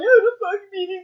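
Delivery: high, loud, tearful yell; crying shout. Retold or imitated. Recapping the fight, the son's sobbing loud outburst. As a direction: wail it out like a beaten kid. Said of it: "he all crying".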